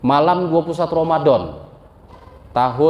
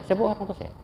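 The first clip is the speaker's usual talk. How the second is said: repeated much softer, as if confessing; quieter repeat; 3 semitones higher, 9 dB quieter, much faster than usual. Shown as a demonstration. Preacher repeats his confession to the audience, softly. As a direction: drop the volume and let the admission sink in. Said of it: "Pak, saya bohongi orang tua saya".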